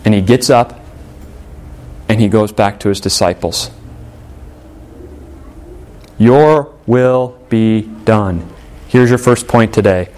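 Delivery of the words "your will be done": each word drawn out and weighed; slow solemn emphasis. Acted. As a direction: let "Your will be done" land slowly and heavily. Repeats the prayer slowly, solemnly.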